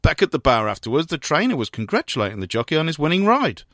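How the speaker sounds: usual speaking voice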